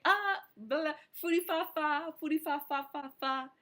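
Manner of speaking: high-pitched fake French babble; hammed-up French-sounding gibberish; mock French gibberish. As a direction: babble nonsense as if fluent in French. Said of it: "I was fluent in French".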